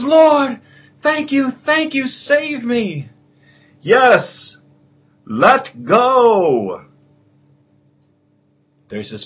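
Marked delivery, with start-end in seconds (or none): 0.0-3.1 high, breathless grateful begging; high-pitched desperate thanks; pleading man. Acted. Man answers God, pleading. 3.8-6.9 loud, higher divine proclamation; grand heavenly voice, loud; booming voice of God. Acted. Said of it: "booming voice".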